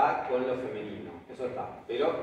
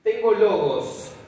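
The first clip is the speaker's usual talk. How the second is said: emphatic announcing; 5 semitones higher, 7 dB louder, slower than usual. Shown as a demonstration. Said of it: "¿Cómo va a aparecer en el diccionario?".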